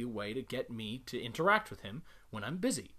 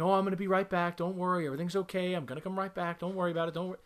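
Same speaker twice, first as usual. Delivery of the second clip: higher, louder coddling voice; mock anxious goodbye; 6 semitones higher, 4 dB louder, faster than usual. Owner soothing the dog before leaving, mock coddling. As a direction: coo reassurance at the dog, pitched up. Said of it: "You should not be saying".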